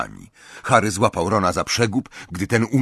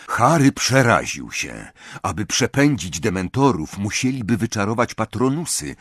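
usual speaking voice

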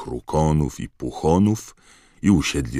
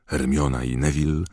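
plain reading voice

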